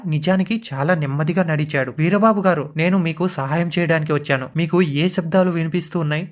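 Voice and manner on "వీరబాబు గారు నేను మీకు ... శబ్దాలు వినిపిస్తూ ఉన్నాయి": ordinary delivery, nothing marked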